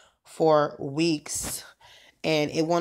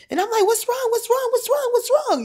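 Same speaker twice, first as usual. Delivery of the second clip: high, loud, urgent repeated question; alarmed; 7 dB louder than usual. Retold or imitated. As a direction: call out in a panic, much higher. Panicked calling during a crisis.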